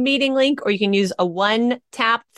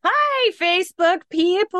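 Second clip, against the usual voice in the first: thrilling greeting; 9 semitones higher than usual. Pretend. Co-host thrills the Facebook audience on request, gushing greeting. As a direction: greet the crowd with a big high lilt. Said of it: "give 'em a thrill".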